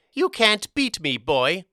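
high, loud goblin taunt; crowing, high-pitched goblin boast; gloating goblin. A narrator's reading. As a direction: taunt the boy in a little goblin voice. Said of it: "the little goblin gloated".

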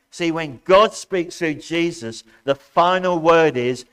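loud, ringing preacher's proclamation; rousing sermon voice; preaching at full force. Acted. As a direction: preach it out to the congregation.

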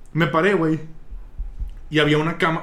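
ordinary talk, nothing marked

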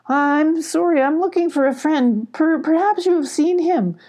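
high, faltering polite plea; timid high-voiced little cat; timid apology. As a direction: apologise nervously, pitch well up. Timid Molly facing hostile badger.

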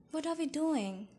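breathless. A narrator's reading. Bella asks Esme as she's set down, mildly breathless. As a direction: ask it with a touch of breathlessness. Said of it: "I asked breathlessly".

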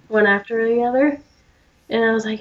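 ordinary talk, nothing marked

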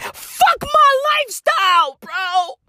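fast, then a high shouted squeal; mock whiny billionaire. Comic mocking Elon's self-pity, fast then squealing. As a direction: rattle it off, then squeal the complaint.

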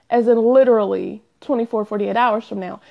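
ordinary talk, nothing marked

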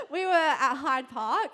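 loud laugh, then slow words through it; giggling loudly while starting the story. Real, not acted. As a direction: laugh hard and push the words out slowly.

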